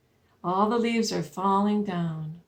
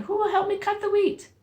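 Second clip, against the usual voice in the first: high hen voice; little red hen; 11 semitones higher, 4 dB louder than usual. Acted. Storyteller voices the hen asking for help. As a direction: ask it like a fussy little hen. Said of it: "little red hen said".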